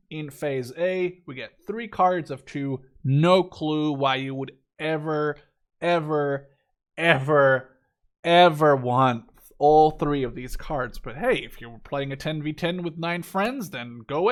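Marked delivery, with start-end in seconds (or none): 3.0-11.0 each ever louder and more exasperated; incredulous ever, ever, ever, rising in volume; emphatic building repetition. Real, not acted. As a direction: stack up the evers, pushing harder each time.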